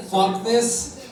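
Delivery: usual speaking voice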